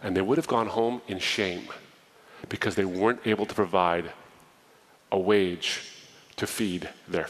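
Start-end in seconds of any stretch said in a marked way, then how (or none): none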